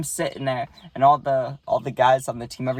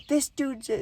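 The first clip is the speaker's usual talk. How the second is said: imitated angry yell; 13 semitones higher, 3 dB quieter than usual. Tall batter complaining to his coach, mock yelling. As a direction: pitch it way up as a complaining kid. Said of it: "he starts yelling".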